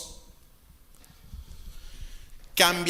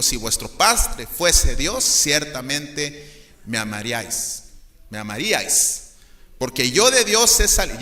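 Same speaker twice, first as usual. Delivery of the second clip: slow, loud, archaic declaiming; old-fashioned Bible reading; 4 dB louder than usual. Acted. Pastor voicing Jesus's reply to the crowd, archaic and solemn. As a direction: intone the old Reina Valera verse. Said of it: "ya me oigo como el aiga".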